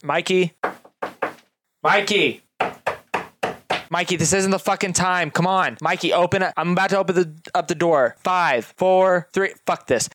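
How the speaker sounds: bang on the door and demand he open; calling through door. Acted. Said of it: "she goes".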